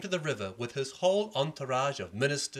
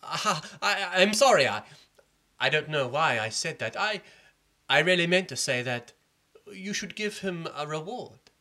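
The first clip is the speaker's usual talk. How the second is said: stammering, higher nervous apology; afraid minister; 5 semitones higher, 6 dB louder than usual. Acted. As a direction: backpedal in panic. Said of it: "The minister became afraid".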